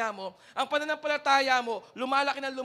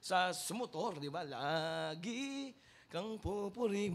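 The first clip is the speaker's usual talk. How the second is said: lower, softer prayer murmured to the Lord; contented prayer; 7 semitones lower, 7 dB quieter than usual. Retold or imitated. Believer prays to the Lord on his motorbike, lower and soft. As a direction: pray humbly, content with little. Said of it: "ganun ka magsalita sa sarili mo".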